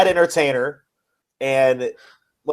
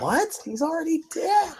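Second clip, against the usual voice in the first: high, soft stunned voice; a hushed, high double take; mock disbelief; 13 semitones higher, 8 dB quieter than usual. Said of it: "I'm like".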